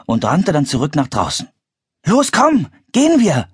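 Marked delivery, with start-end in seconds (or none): 2.1-3.5 high, eager call to go; rally them to set off, pitched high; urging on. A narrator's reading. Child urges sister to leave. (hoch und antreibend)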